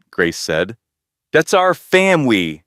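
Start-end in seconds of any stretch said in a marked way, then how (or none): 1.3-2.6 put-on higher, louder proud little girl; a child showing off her picture; proud announcement. Emma proudly telling her parents about the drawing, higher and louder. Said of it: "Emma announced proudly".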